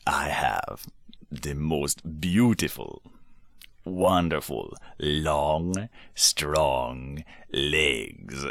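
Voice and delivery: bragging Donkey. Acted. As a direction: show off your legs. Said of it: "Donkey would say things like".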